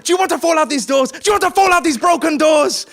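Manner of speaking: high, very loud furious yelling; raging. Acted. Driver threatening passenger while speeding off, raging yell. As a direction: bellow the threat in a rage. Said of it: "it's a kind of rage".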